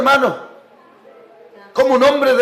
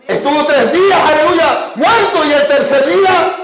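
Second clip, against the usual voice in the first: raised, ringing sermon proclamation; fervent preaching; 5 semitones higher than usual. Real, not acted. Pastor proclaiming the resurrection to his congregation, fervent preaching. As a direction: proclaim it high to stir up amens.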